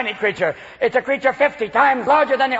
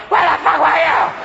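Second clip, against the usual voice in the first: loud and higher in the doorway; angry wife; 5 semitones higher, 5 dB louder than usual. Acted. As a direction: yell it at the late husband. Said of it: "waiting for you in the doorway like".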